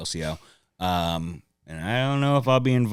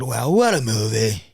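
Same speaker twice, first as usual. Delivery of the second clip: lightly put-on, hammy impression voice; running impression; 4 dB louder than usual. Comic retelling what he said walking in, mock impression. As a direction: push the bit a little too hard. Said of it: "I'm getting worse".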